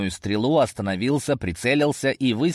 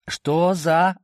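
higher-pitched, mildly frightened exclamation; startled cry; 7 semitones higher, 3 dB louder than usual. A narrator's reading. Frightened lad cries out as the sand moves, startled. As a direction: blurt it out in alarm. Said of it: "воскликнул испугавшийся парень".

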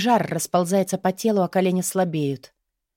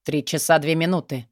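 usual speaking voice

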